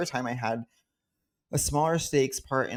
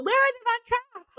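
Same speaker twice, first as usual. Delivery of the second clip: squeaky high mock whine, way up; 4 dB louder than usual. Girl mocking a friend at a party, squeaky high voice. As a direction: mimic a snooty girl mocking you, pitched way up. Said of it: "making fun of".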